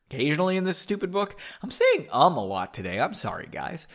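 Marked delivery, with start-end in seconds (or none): none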